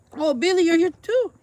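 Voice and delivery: high-pitched fussing over a cat; delighted pet greeting. Real, not acted. Owner greets Billy the cat arriving, high and delighted.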